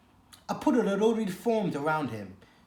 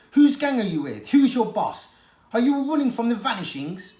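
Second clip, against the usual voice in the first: louder, higher rapid-fire demands; loud interrogation; 7 semitones higher, 8 dB louder than usual. Acted. She-cat interrogates Varjak, loud and pushy. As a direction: grill him hard.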